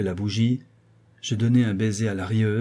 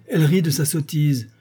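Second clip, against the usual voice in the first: angry complaint; 5 semitones higher, 5 dB louder than usual. A narrator's reading. Marquis angry behind closed door.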